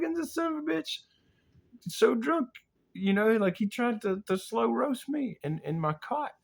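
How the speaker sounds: very high, strained indignant voice; hammed-up falsetto indignation; squeaky outrage. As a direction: rant in a squeal of disbelief. Acted outrage at drunk friend.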